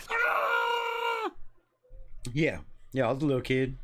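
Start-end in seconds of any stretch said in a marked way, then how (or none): 0.1-1.3 very high-pitched held "Ah!"; mock scream. Fan recalls screaming for a week over Raiders news, high mock scream. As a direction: imitate a kid's long, high scream. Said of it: "just running around screaming".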